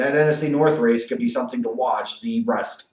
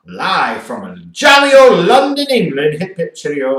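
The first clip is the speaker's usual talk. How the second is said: a bad, hammed-up English accent, louder and higher; 4 semitones higher, 9 dB louder than usual. Podcast host hamming a bad English accent for London game, mock English accent. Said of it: "I can't do an English accent".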